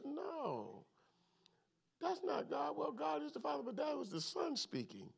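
high, hushed aside; soft, high-pitched mock protest to yourself; retold silent correction. His silent reaction at a funeral.

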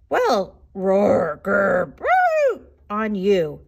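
loud mocking animal noises; giant's yell. A narrator's reading. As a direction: yell it, mimicking each animal in turn. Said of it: "he yelled".

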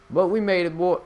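loud, high "we made it"; triumphant shout. Real, not acted. Gamer cheering as he pulls up, loud and high.

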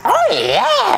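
very high squeaky "oh yeah"; Toad-style cheer. Acted. Player showing off a game character voice, high squeal. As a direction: exclaim it in a high-pitched cartoon voice.

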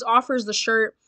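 ordinary talk

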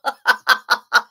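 loud, breathy burst of laughter. Real, not acted. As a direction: crack up in a run of ha-has. Laughs at own sponsorship question.

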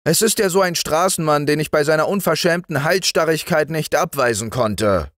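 high, hurried explanation; wife making excuses. A narrator's reading. Wife makes excuses to her husband. (sich herausredende Ehefrau)

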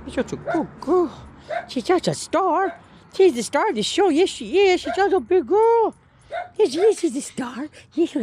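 high, singsong praise. Real, not acted. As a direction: gush over the dog. Praising the dog.

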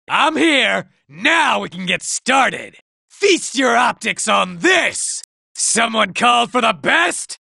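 ordinary delivery

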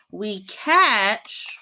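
ordinary delivery, nothing marked

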